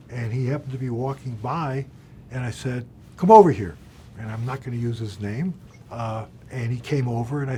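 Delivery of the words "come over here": loud, commanding call across the floor; a firm shout to summon someone; calling out sharply. Retold or imitated. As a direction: bark him over to you, high and loud. Summoning anti-Semite broker over.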